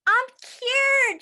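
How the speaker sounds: high, loud happy shout; joyful shout. A narrator's reading. Camilla cured at last, high joyful shout. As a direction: shout for joy. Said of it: "she shouted".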